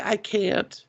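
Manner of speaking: small helpless pixie reply. Retold or imitated. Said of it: "She's like".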